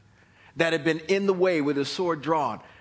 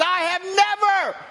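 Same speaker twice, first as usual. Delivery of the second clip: talking donkey; 8 dB louder than usual. Acted. Donkey protesting to Balaam about the beating, loud high plea. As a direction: plead as a wounded, loyal animal.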